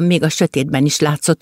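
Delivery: plain reading voice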